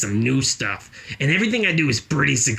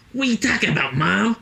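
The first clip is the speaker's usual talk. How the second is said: put-on high, baffled child; a little boy asking mom, pitched way up; confused kid; 7 semitones higher than usual. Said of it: "So I was like".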